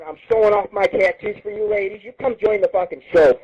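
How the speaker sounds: ordinary delivery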